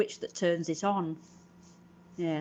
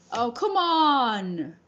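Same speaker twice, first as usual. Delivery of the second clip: slow, louder exasperated "come on"; frustrated groan; 5 semitones higher, 7 dB louder, much slower than usual. Real, not acted. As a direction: drag it out, fed up with the camera. Camera keeps flipping image.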